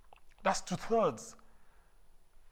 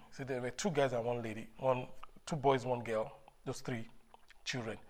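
ordinary delivery

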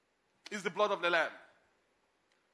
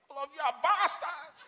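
higher, mock-angry outburst at the drivers; hammed-up traffic fury; mock road rage; 7 semitones higher than usual. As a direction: blurt it like a driver losing his cool.

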